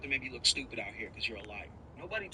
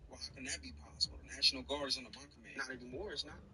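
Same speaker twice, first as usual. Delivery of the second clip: usual speaking voice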